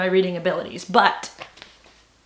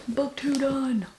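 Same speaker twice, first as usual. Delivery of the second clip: slow, softer, drawn out Book two done; drawn-out repeat; 4 semitones higher, 5 dB quieter, much slower than usual. Real, not acted. Reader savouring a finished book, slow soft repeat.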